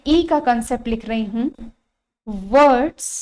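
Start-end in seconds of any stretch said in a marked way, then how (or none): none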